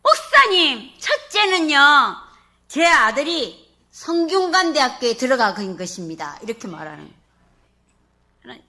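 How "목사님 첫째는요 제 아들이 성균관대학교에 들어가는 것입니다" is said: loud, high, joyful announcement; the deaconess joyfully tells the pastor her blessing. Retold or imitated. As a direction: proclaim it with joy, no hesitation. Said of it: "조금도 주저움 없이 기쁨으로".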